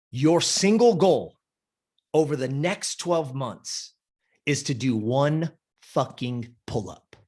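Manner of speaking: slow, each phrase spaced out; slow deliberate. Retold or imitated. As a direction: lay down the condition slowly. Coach laying down single condition.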